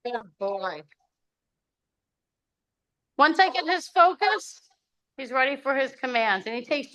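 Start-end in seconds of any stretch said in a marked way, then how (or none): 0.0-0.8 soft, slow, gentle praise; soft praise to dog. Real, not acted. Dog owner softly praising her dog, gentle and slow. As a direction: murmur it to your dog.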